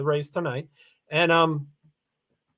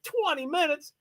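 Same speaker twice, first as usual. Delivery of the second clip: high disbelieving question; imitating his own disbelief, pitched up; high, incredulous quote; 8 semitones higher than usual. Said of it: "and I'm like".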